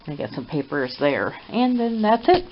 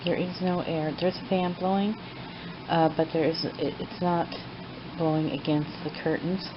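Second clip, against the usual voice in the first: hushed late-night talk; 12 dB quieter than usual. Real, not acted. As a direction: talk quietly as if it's midnight. Late night, watching the cat quietly.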